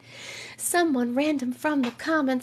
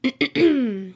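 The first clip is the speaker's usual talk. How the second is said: cowboy yeehaw. Acted. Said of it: "Cowboys say that".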